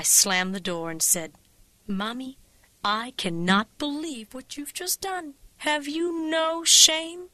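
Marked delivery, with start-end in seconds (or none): 1.9-7.3 slow, building to a loud high demand; exasperated reproach. Acted. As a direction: drag it out, outraged. Daughter scolding mother after slamming door.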